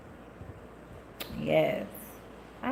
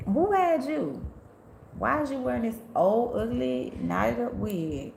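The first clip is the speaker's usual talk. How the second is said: sassy clapback; 4 semitones higher, slower than usual. Retold or imitated. Imagined clapback at old ladies.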